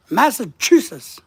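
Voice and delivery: "Massachusetts" with the accented syllable hit hard and loud; stressed syllable. Shown as a demonstration. Speaker demonstrating word emphasis for viewers, hard syllable stress on "Massachusetts". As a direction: punch one syllable of "Massachusetts", hurry the rest. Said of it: "emphasizing part of the word".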